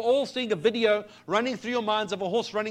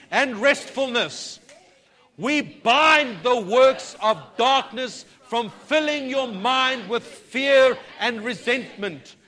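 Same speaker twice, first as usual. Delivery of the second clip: building from loud decree to shouted, higher praise; preaching at full force; 5 semitones higher, 10 dB louder, much slower than usual. Acted. Pastor leads the church in a rising decree, shouted. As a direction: proclaim the victory, rising to a roar.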